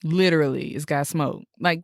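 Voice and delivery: ordinary talk, nothing marked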